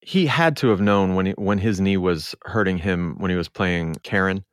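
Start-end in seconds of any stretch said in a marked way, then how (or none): none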